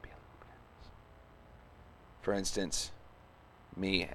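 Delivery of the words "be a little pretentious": a quiet, self-mocking whisper to himself; whispered aside. Real, not acted. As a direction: whisper it as a private joke.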